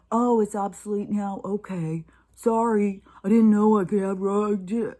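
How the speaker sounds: loud, hammed-up capitulation; mock apology. Vlogger mocking people who give up their rights, mock apology.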